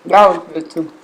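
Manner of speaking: talking with food in mouth; chewing while talking. Real, not acted. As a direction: speak through a mouthful. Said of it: "if I'm chewing with my mouth open".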